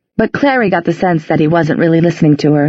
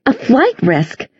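slow, pitched-up outraged echo; indignant demand; 7 semitones higher, slower than usual. A narrator's reading. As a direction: throw the phrase back in disbelief. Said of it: "Isabel demanded".

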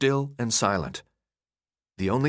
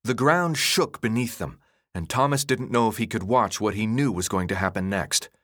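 usual speaking voice

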